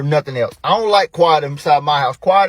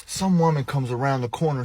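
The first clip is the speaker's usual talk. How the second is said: ordinary talk, nothing marked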